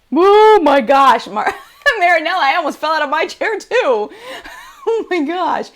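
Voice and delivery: high, loud, laughing amazement; laughing in disbelief. Real, not acted. Streamer thanking a viewer for another donation, laughing in shock. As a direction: laugh it out, overwhelmed.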